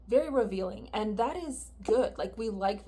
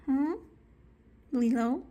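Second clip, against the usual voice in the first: raised calling voice; calling the cat; 3 semitones higher, 5 dB louder than usual. Real, not acted. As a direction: call out loud to the pet.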